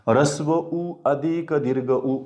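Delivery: usual speaking voice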